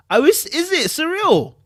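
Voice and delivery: high, loud surprise at the number; surprised. Real, not acted. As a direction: gasp it out in disbelief. Reacts to one percent stat.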